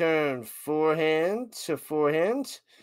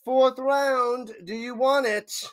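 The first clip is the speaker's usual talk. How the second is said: higher-pitched mock offer; imagined player offer; 6 semitones higher than usual. Commentator voicing the player giving away the break, mock offer. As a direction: voice her handing it over, higher. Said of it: "She's like".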